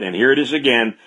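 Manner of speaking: ordinary talk, nothing marked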